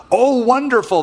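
high, gushing oh wonderful; impressed traveler. Retold or imitated. Caravan traveler impressed by Abram's name, high gushing voice. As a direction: sound delighted and impressed. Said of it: "they would say".

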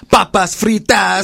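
put-on loud, drawn-out Spanish accent; mock Spanish accent. Mocking people who switch languages mid-sentence, exaggerated Spanish accent. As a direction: lay the Spanish accent on thick. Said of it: "you're not gonna say con".

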